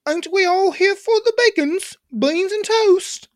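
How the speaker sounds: high-pitched, hammed-up English accent; British accent. Shown as a demonstration. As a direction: play a silly high-voiced Brit. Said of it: "British English accent".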